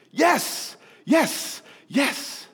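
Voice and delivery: high-pitched celebratory cheer; excited crying out. Acted. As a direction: re-enact a fan's thrilled yelps at a winning catch. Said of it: "excitement caused me to cry out".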